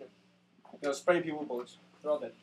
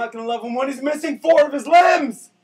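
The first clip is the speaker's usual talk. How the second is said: mock shout; 3 semitones higher, 7 dB louder than usual. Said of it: "And I was just like".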